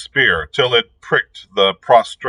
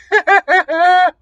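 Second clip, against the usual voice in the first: high, gleeful witch cackle; ghastly cackle; 5 dB louder than usual. A narrator's reading. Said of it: "writhed in ghastly merriment".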